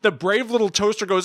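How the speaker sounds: usual speaking voice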